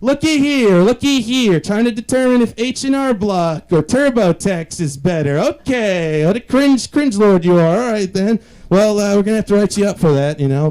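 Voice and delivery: squeaky mock inspector; nosy snoop voice. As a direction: gleefully catch me out like a snooping neighbour. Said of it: "and be like".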